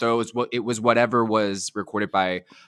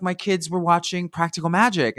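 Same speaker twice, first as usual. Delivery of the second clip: put-on a second go at the Australian accent, a little higher; faint Aussie accent, raised pitch; Nicole's Aussie accent; 7 semitones higher than usual. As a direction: lightly mimic an Australian actress again. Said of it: "I can't even do the Aussie accent".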